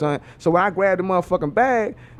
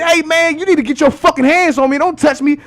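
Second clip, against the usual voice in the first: high, loud retold warning; acted angry warning; 10 semitones higher, 5 dB louder than usual. Telling the passenger hands off, acted angry warning. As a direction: warn him off loudly, play-acting.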